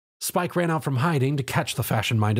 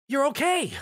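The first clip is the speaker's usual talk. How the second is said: very high relieved cheer; 14 semitones higher than usual. A narrator's reading. Twilight cheers as Tom wakes, very high. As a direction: burst out with joy. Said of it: "Twilight cheered".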